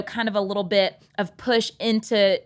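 ordinary talk, nothing marked

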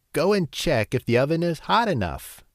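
high, bossy witch order. A narrator's reading. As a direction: command her like a wicked witch. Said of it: "said the witch".

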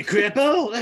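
put-on taunting drunk at a pool table. Voicing drunk guy at bar.

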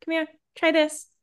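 high-pitched coaxing, as if to a puppy; high, mock-sweet dog-calling voice. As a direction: lure him over like a little dog. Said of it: "calling to him like a little dog".

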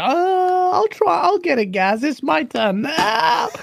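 high, drawn-out strained imitation; mock struggling. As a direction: act out straining to reach the wallet. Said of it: "struggling to get your card out".

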